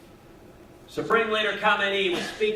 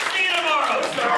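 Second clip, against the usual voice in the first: put-on cheerful old man; 11 semitones higher, 7 dB louder than usual. Old man's cheerful parting line.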